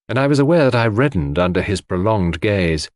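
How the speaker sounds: ordinary delivery, nothing marked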